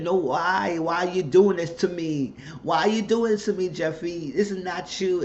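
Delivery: high-pitched imploring retold plea; retold pleading mother. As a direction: act out a mother's distressed questioning.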